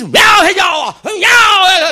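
loud, high cowboy holler; John Wayne. Retold or imitated. John Wayne outrunning the chase, tough hollering. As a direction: shout wildly as if driving a team. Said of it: "And John Wayne, he's tough".

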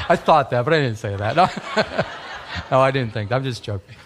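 chuckling real disclaimer; words broken up by laughter; laughing. Real, not acted. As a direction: laugh while taking back the joke. Retracting own joke, laughing.

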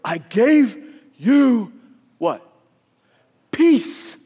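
voice of God. Retold or imitated. God answering the discontented believer, slow and emphatic. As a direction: hand it over deliberately, as God. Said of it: "And God's go".

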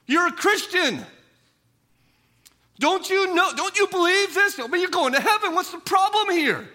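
high, loud impatient rebuke. Pretend. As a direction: brush off a grieving friend, exasperated. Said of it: "We would think".

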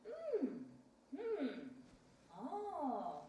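high falling "mm", imitating interest; drawn-out musing hums and an "oh"; intrigued humming. Husband absorbed in his bedtime reading, intrigued hums. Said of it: "I hear him going like".

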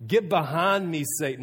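loud, sharp retold rebuke of Peter. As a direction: snap it out as Jesus rebuking.

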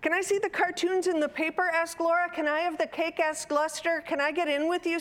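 put-on high, rapid-fire child voices; pestering children. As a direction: pile on requests without a breath, pitched high. Children pester mother in quick succession.

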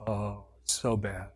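low, slow, limp moan; mopey wallowing. Retold or imitated. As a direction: sigh it out like a wallower. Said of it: "like a wallower".

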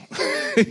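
sudden high laugh at himself; high burst of laughter. Real, not acted. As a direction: laugh at your own joke, high and quick. Laughs at his own weak faith.

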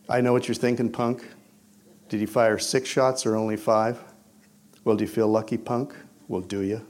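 low, restrained tough-guy menace; Clint Eastwood. Acted. Mentor likened to movie gunman taunting a punk, cool menace. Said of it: "God's Clint Eastwood".

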